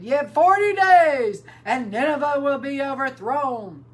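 loud, high prophet's proclamation; crying out. Acted. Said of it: "he cried and said".

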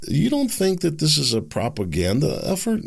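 usual speaking voice

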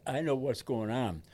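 normal talking voice

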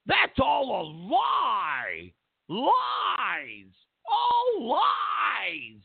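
whiny high mock denial, wailing; mock British officials. Mocking British officials wailing their denial, hammed-up fake crying. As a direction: wail the denial like a hammed-up crybaby. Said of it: "They're like".

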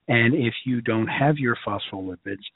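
usual speaking voice

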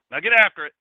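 loud barked "Get after it"; Dennis's shout. Retold or imitated. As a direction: shout it like a pumped-up coworker. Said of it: "Dennis would say".

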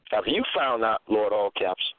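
ordinary talk, nothing marked

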